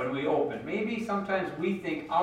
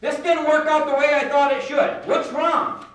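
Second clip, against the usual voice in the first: higher and louder, venting hard at God; angry outburst; 9 semitones higher, 6 dB louder than usual. Retold or imitated. Jesse telling God straight out, angry and loud. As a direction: blurt out the frustration, loud and raw. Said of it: "Jesse's been so mad".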